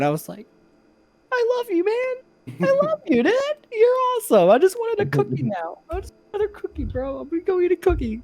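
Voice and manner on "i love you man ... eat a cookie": tiny very high falsetto, imitating a weepy kid; mock tearful gratitude. Crying kid thanks convict who defended him, mock tearful squeak. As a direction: squeak it out like a grateful crying child.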